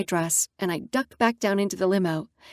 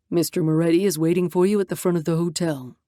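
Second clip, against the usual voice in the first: put-on older driver; 3 semitones lower, faster than usual. Driver informs Natalia.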